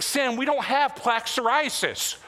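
dad telling son Sam they don't need the drug, lightly exasperated; mock parent reply. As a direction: answer the kid, half amused. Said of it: "It's like".

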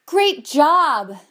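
imitated shout of praise; mock audience shout. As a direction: call it out like an admiring crowd. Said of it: "members of the audience shouted".

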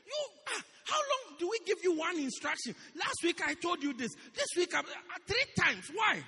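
high, loud scolding that ends in a shout; harsh scolding. Shown as a demonstration. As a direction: berate them like a fed-up boss. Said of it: "But if you can say".

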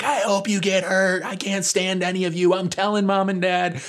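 high-pitched furious sister impression; imitated fury. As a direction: rant in a high mock tantrum. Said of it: "she's just furious".

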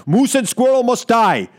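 a light Boris Badenov Russian accent; cartoon Russian accent. Radio host doing a cartoon Russian villain. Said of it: "my Rocky and Bullwinkle".

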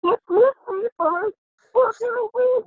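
high, thrilled cry of imitated players; excited kids. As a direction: yell it like kids spotting their mascot. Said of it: "The kids just go".

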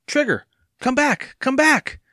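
high-pitched, urgent cry; desperate calling. A narrator's reading. Evan running after his galloping dog, desperate calling.

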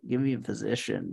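ordinary delivery

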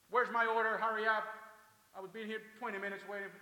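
loud, high demand for service; impatient customer. Acted. Customer yelling at the cashier, angry and impatient. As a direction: shout it like a fed-up customer.

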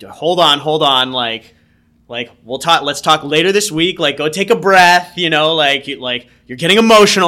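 the boss scrambling to stop him, pressing harder; alarmed manager. Retold or imitated. Manager reacting to sudden quitting.

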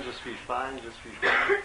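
usual speaking voice

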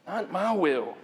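normal talking voice

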